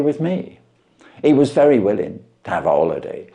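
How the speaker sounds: measured storytelling voice for a classic tale; calm, steady reading of an old detective story; story narration. A narrator's reading. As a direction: read it like an audiobook narrator. Reads a Sherlock Holmes story aloud.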